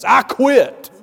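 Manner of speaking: loud, high yell of giving up; desperate yell in the hallway; screamed quit. Retold or imitated. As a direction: shout it out in frustration. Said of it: "I just screamed out to that circumstance".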